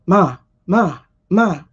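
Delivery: lightly voiced teenage son calling for his mother; teen boy voice. Lightly imitating the son heard at night, a hint of a cracking teen voice. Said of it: "a little deep voice, like, a little cracky voice".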